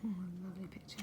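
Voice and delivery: fast breathy aside; quick whisper. Real, not acted. Parent admiring the picture with child.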